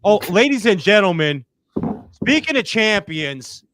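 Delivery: loud ringside announcer. Pretend. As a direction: introduce the champ like a ring announcer. Announcing the champion.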